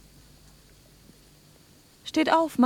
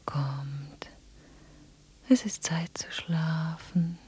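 soft, lower, slow voiceless words; lulling whisper; 3 semitones lower, 6 dB quieter, slower than usual. Acted. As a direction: whisper them to sleep. Anna lulls them to sleep. (einlullendes Flüstern)

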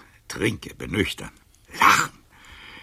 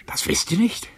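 incredulous high rise; 4 dB louder than usual. Acted. Old Casanova's bitter tirade.

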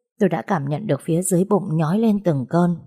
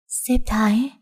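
higher-pitched, rising disbelief; startled question; 5 semitones higher, slower than usual. A narrator's reading. Patient hears who sent help, astonished questioning. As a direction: echo the name as a stunned question. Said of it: "Tôi tròn mắt nhìn Tài".

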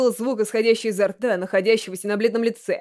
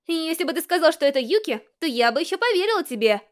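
put-on higher, prim girl's voice on the phone; Haruhi, refined voice; 6 semitones higher than usual. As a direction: lecture him in a refined tone. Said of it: "говорил это утончённым голосом".

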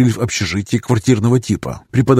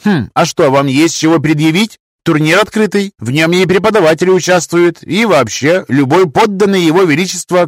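stern professor; 6 semitones higher, 4 dB louder than usual. Acted. Professor challenges student in lecture.

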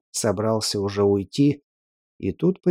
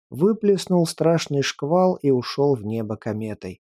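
usual speaking voice

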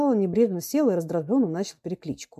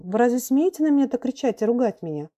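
higher indignant, bewildered question; flustered, trembling; 6 semitones higher, 4 dB louder than usual. A narrator's reading. Pupil challenges the shouting gentleman, flustered. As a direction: protest in confused disbelief. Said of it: "дрожащим, растерянным голосом".

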